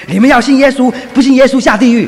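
loud, high shout on the bus; street preacher's shout. Retold or imitated. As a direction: yell it out to wake the whole bus. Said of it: "很大的声音喊说". (高声大喊)

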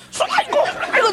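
mock teacher shout. Teacher on the platform demands the culprit, high mock yell. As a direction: imitate a teacher yelling in a high, strained voice. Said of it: "在叫哈".

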